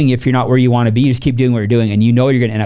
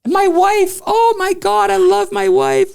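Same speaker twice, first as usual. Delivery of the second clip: hammed-up weepy voice, much higher and slower; mock crying. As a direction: fake tears, overplayed whiny sob. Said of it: "I'm pretending to cry".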